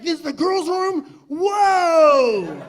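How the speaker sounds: high wondering voice rising to a loud whoa; loud, drawn-out mock wonder; acted amazement. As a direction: gape in hammed-up awe. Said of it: "I'd be like".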